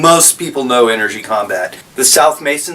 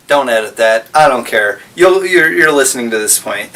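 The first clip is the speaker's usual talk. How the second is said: ordinary talk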